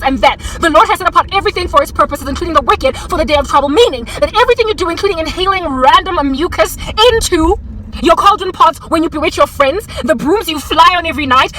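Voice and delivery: usual speaking voice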